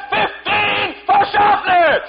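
fan cheer. Retold or imitated. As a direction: roar it from the stands. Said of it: "They said".

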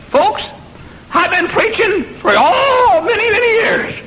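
put-on high, thin, trembling old preacher; squeaky old man voice. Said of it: "in a squeaky voice".

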